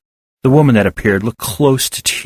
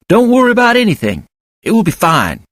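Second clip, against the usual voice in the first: reassuring; 11 semitones higher than usual. A narrator's reading. Reassuring children.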